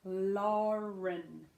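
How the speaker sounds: "Lauren" drawn out, gliding from L into R; stretched-out "Lauren", each sound held; slow pronunciation. Shown as a demonstration. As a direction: go slow through "Lauren" for a learner. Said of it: "go slow".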